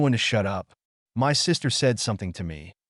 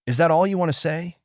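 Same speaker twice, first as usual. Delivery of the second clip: exclaiming; 8 semitones higher, 4 dB louder, faster than usual. A narrator's reading. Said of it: "she exclaimed".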